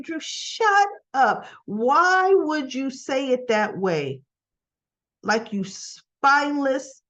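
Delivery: slow, drawn-out telling-off; exasperated scolding. Acted. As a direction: scold him, stretching the words out. Her scolding retort to Drew.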